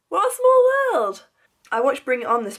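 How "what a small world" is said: much higher, bright exclamation; squeaky small-world surprise; amazed. Real, not acted. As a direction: marvel at the coincidence. Amazed the mechanic is South African.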